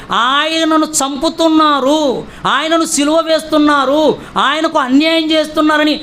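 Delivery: ordinary talk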